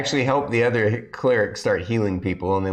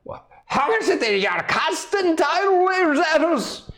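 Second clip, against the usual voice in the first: wizard. Acted. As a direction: demand it high, baffled. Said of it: "the wizard's like".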